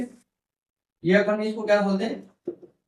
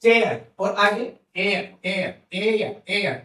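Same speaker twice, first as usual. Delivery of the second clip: slow vowel demo; slower than usual. Shown as a demonstration. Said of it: "ठीक है।".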